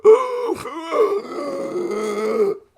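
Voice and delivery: long, high exaggerated gasp for air; imitated gasp. Streamer to chat, acting out his hiccup gasp. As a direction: show the hiccup gasp as a sharp intake. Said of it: "every five seconds you go".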